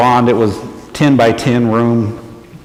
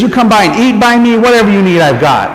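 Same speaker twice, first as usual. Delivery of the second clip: eager shopkeeper; 7 semitones higher, 3 dB louder than usual. Retold or imitated. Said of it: "He says".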